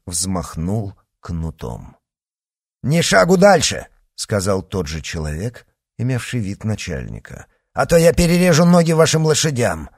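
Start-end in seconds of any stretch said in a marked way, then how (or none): 2.8-3.9 high, loud command to halt; threatening shout. A narrator's reading. Leader halts the carriage. 7.7-9.9 high, loud, forceful warning; shouted threat. A narrator's reading. Leader threatens the carriage.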